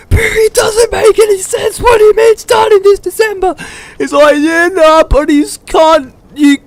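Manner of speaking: loud, very high cartoonish complaint; mocking protest. Boys call out Barry's bullshit clown story, high mocking squeal. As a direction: squeal it in exaggerated disbelief.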